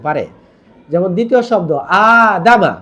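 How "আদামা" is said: আদামা with a long আ and a quick দামা; Quranic word recitation. Shown as a demonstration. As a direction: stretch the first আ of আদামা, then say দামা fast. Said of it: "আতে টান আর দামা তাড়াতাড়ি".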